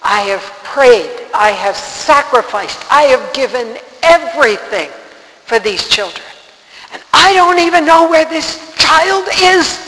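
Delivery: anguished protest building to a high, loud shout; retold screaming at God. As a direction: start firm, then cry out accusingly at God. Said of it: "I was screaming at God".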